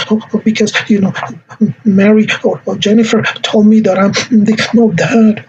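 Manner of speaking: high, halting mock sobs; crying child. Shown as a demonstration. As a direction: blubber an explanation like a hurt child. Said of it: "And the child may say".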